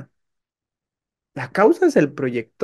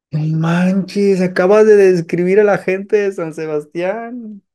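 surprised; 4 semitones higher, 5 dB louder than usual. Acted. Visitor amazed at same description.

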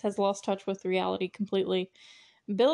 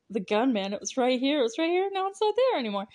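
whiny panic climbing much higher in pitch; Shaggy; 5 semitones higher, faster than usual. Retold or imitated. Movie fan mimics Stu missing the gun, climbing Shaggy-like whine. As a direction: imitate a cartoonish fretting sidekick, rising as it goes. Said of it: "He kinda almost slips into Shaggy voice".

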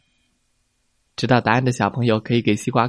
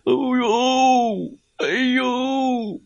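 high pained ouch; 12 semitones higher, much slower than usual. Acted. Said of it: "疼得他哇哇的大叫".

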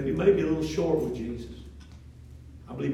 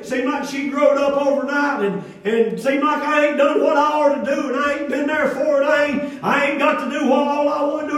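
rising, louder preaching cadence; 10 semitones higher, 7 dB louder than usual. Acted. Pastor exhorting the congregation, emphatic preaching.

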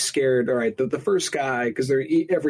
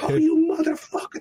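mock kid curse.

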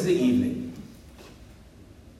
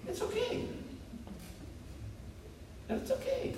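very high, much softer reassurance; a high, hushed mock comfort; soothing falsetto; 14 dB quieter than usual. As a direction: coo it to yourself like comforting a child. Soothing himself through the pain.

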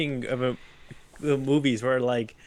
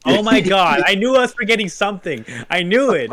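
loud, higher outburst of sudden recall; excited realization; 6 semitones higher, 6 dB louder than usual. Real, not acted. Speaker reminded of the film they were forgetting, excited realization. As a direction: burst out loud as it comes back to you.

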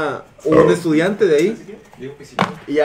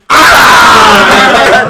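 loud, slow, laughing yell; real laughing yell; excited shout; 3 semitones higher, 10 dB louder than usual. Real, not acted. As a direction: cry out at the replay. Shouts watching replay.

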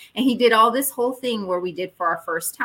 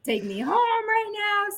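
tearful demand; 13 semitones higher, slower than usual. Retold or imitated. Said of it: "I was crying".

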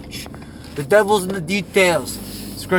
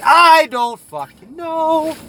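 high, loud, slow drawn-out frustration; loud exasperated whine; exasperated yell; 10 semitones higher, 4 dB louder, much slower than usual. Acted. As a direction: throw up your hands. Exasperated answer.

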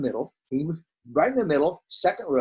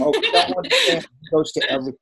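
laughing; 11 semitones higher, 4 dB louder, faster than usual. Real, not acted. Joking about always attending classes.

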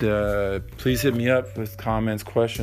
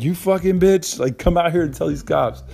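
much higher, louder screaming at the neighbor; furious yell; 9 semitones higher, 4 dB louder than usual. Retold or imitated. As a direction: scream it at her across the street, enraged. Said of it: "I was yelling".

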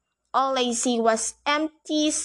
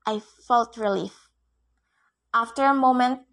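ordinary delivery, nothing marked

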